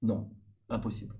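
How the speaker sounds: usual speaking voice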